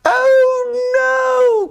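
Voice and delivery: very high, loud dismayed cry; sisters crying out. Acted. Sisters crying out at the broken coaster. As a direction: wail it in dismay. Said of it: "cried the sisters".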